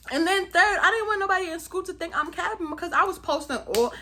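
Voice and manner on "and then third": ordinary delivery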